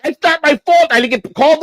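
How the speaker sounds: high-pitched, protesting self-defence, retold; indignant protest. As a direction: plead your innocence, acting it out. Retold protest to halfway house.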